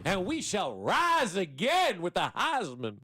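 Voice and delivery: slow, swelling Southern gentleman drawl; rally them like a hammed-up Southern orator; high, drawn-out mock Southern proclamation; fake Southern accent. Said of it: "why does every Southern accent do start".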